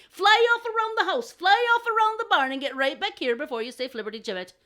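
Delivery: high, loud, insistent crow; challenging crow. Acted. Crows disputing who is finest, challenging. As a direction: hammer out the dare, piling up orders.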